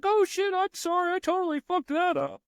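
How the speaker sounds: very high, sheepish mock AI voice; mock apologetic AI. Coder imitating the AI apologizing, high and sheepish. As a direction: squeak out a flustered apology. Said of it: "It's like".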